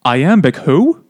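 loud, baffled mock question; lost, mock confusion. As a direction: play her as utterly clueless. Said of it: "Vittoria looked lost".

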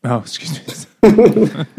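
slow, laughing apology. Real, not acted. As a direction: laugh through the words. Laughs at own slip.

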